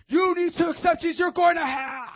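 high, screamed hellfire threat; hammed-up furious preacher yelling; angry preacher. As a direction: scream it like an over-the-top Doctor Angry. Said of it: "we're not Doctor Angry".

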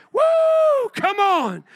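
very high whoop then a shouted come on; excited whoop. Real, not acted. Preacher firing up the church, high whooping shout. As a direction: celebrate out loud to the crowd. Preacher exults after verse.

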